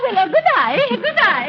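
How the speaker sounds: normal talking voice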